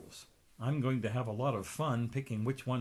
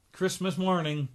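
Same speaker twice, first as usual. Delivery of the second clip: plain reading voice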